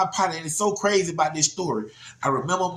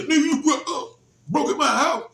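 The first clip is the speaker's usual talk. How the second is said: retold yell; 10 semitones higher than usual. Holmes yells while grabbing him.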